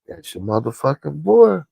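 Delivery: high, loud needling taunt. Real, not acted. Vlogger throwing "your boy" at fans, loud high taunt.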